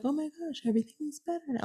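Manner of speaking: high, breathy mock cheer; sarcastic fake relief. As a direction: sneer at the happy news. Mocking the official good news.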